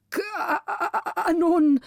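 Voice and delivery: high, slow, faltering cry of fright; faint stammer. A narrator's reading. Frightened councillor stammers at the sight of the cannons, faintly. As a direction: stammer the word out, weak with terror. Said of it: "stotterte er mit matter Stimme hervor".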